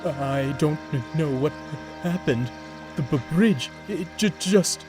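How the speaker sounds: tearful, rising stammer; stammering upset. Acted. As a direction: stumble over every word. Horace stammers after the crash.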